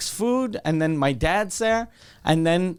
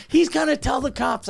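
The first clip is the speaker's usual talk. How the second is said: freaking out; 9 semitones higher than usual. Retold or imitated. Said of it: "she's freaking out".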